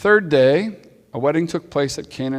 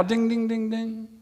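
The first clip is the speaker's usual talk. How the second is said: high chiming dings, tailing off softer; imitated bell; 8 semitones higher, 4 dB quieter, slower than usual. Preacher signalling another hint after the verse, imitated bell.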